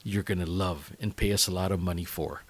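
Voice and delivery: ordinary delivery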